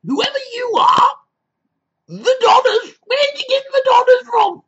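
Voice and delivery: very high, loud, squealing put-on voice; shrill ranting persona. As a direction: screech like an indignant old lady threatening to sue.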